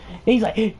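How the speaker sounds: ordinary delivery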